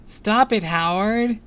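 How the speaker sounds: put-on high, loud protesting child; shouting. A narrator's reading. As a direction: shout in protest at a teasing kid. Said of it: "I heard Harmony shout".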